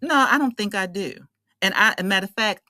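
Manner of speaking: ordinary talk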